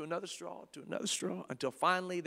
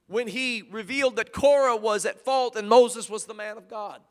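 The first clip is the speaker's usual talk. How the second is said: loud, higher, hammering preacher; booming revival preaching; preaching at full force; 4 semitones higher, 7 dB louder than usual. Acted. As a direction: thunder out the whirlwind and fire. Thunders through the manifestations.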